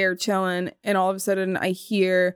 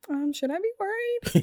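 nervous; 10 semitones higher, 5 dB quieter than usual. Retold or imitated. Retold scared reaction.

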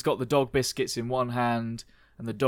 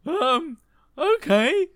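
high voice; 6 dB louder, much slower than usual. Acted.

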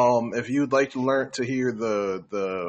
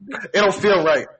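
giggling through the line; laughing; 6 semitones higher, 8 dB louder than usual. Acted. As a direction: laugh it out, mock serious. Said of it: "Please stop".

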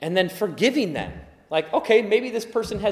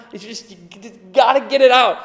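louder, higher frustrated outburst; mock venting; 4 semitones higher, 6 dB louder than usual. Worshipper voicing pent-up frustration, louder and higher. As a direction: act out the need to vent it all.

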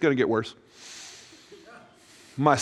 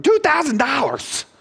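high, slow stunned echo of the price; shocked disbelief; 9 semitones higher, slower than usual. Retold or imitated. Telling the dentist, staggered by the two-thousand-dollar quote. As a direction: repeat the price as if it can't be real. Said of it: "I'm like".

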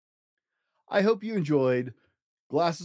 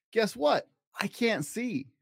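put-on higher, teasing girlish voice; playful little girl; 8 semitones higher, slower than usual. Girl telling Buster news, higher playful voice. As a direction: announce it with childish glee.

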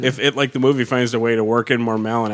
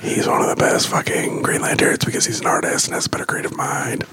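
whispering. Real, not acted. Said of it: "Why are we whispering?".

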